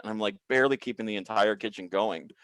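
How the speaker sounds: normal talking voice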